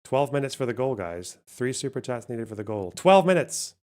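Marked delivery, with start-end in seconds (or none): 3.0-3.7 loud call-out. Real, not acted. Says the goal timer again.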